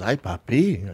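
low, soft broken-English come-on; mock maid accent. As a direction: purr it like the maid, lightly accented. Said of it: "Her English is terrible".

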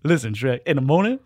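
loud cartoon sidekick voice; Shrek movie impression. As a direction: play the eager sidekick, loud and animated. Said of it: "like Shrek, really".

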